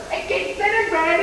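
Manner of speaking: normal talking voice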